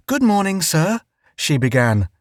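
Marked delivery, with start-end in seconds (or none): 0.1-1.0 high, sweet company voice; overly polite greeting. A narrator's reading. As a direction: greet the native like a hostess.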